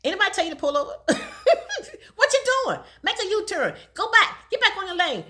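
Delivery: mock GPS nagging; bossy GPS voice. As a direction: GPS telling the driver to turn back, bossy and scolding. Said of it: "it's talking all kinds of static to me".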